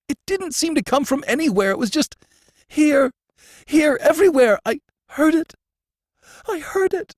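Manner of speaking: high, loud, hysterical insistence; rising panic, near screaming; frantic terror. Acted. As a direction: prisoner insisting he heard it. Terrified prisoner insisting.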